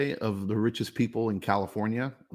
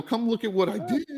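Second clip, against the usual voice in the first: higher, laughing with pride; proud, laughing; 12 semitones higher than usual. Retold or imitated. Contractor retells inviting rich clients to see his work, proudly laughing. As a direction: show off your work while laughing. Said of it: "I go".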